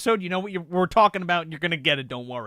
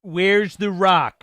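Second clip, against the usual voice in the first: slow drawn-out; 7 dB louder, much slower than usual. Retold or imitated. Mock quoting the question.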